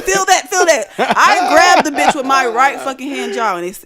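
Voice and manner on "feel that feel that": much higher, louder, laughing; laughing urging. Retold or imitated. James urging the speaker to touch the can, laughing. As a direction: push it on them, giggling. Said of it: "He's like".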